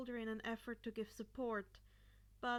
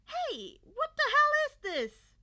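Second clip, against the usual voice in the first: much higher, loud startled yell; startled shout; 14 semitones higher, 18 dB louder than usual. A narrator's reading. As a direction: yelp in outrage as the scarf grabs you. Kid suddenly wrapped in scarf.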